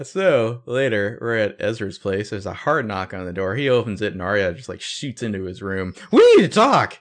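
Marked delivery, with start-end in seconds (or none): 6.1-7.0 high, loud dramatic outburst; urgent shout. Retold or imitated. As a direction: burst in yelling, mock-frantic. Acting Arya bursting into room.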